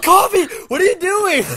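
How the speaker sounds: shrieked mock disbelief; loud high exclamation. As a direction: yell it high in mock horror. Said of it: "and we're like".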